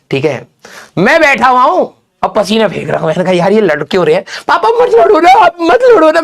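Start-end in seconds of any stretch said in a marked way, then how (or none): 4.5-6.2 put-on high, loud tearful child begging; crying plea. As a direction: beg the parents to stop, close to tears. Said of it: "अपनी tension में अलग रो रहा हूं". (रोते हुए बच्चे की ऊँची, ज़ोरदार मिन्नत)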